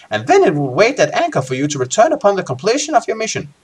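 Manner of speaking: high, swooping character voice; Baindon's voice. Acted. Guildmaster briefing Lone Wolf on the voyage, high put-on voice. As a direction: put on a high guildmaster voice with big pitch swings. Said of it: "says Baindon".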